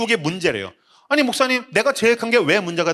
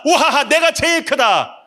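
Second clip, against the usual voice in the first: mocking gloat; 9 semitones higher, slower than usual. Mocking the boastful world.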